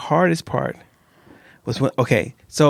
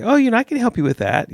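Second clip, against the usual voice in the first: helpful colleague; 10 semitones higher than usual. Retold or imitated. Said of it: "then I'll say".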